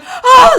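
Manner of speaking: high, loud mock scream; freaking-out scream. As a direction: shriek as if terrified of the knife. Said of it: "I'm, like, freaking".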